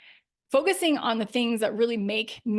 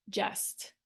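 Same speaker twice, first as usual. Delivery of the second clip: whispered just; 10 dB quieter than usual. Shown as a demonstration. Coach showing a weak filler word, whispering. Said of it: "We often water down our message with words like".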